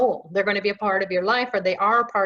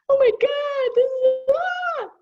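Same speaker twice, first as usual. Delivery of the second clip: much higher, frantic freaking-out gasp; hammed-up alarm, voice shooting up; mock panic; 3 dB louder, slower than usual. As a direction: act out a panicked soul losing it. Mock panic over uncertainty.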